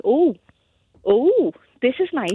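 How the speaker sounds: swooping, drawn-out oohs of wonder; mock-impressed, gliding up and down on each oh; acted awe. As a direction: imitate a newcomer being impressed by luxury. Acting out MPs awed by palace.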